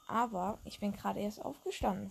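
ordinary talk, nothing marked

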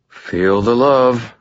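dry, slow sarcastic sigh; sighing sarcasm. A narrator's reading. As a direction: drag it out wearily. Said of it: "I sighed".

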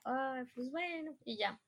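soft, slow sigh of resignation; resignado. Retold or imitated. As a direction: settle for it, quietly and slowly. Resigned reaction to the lesser job. (resignado, en voz baja)

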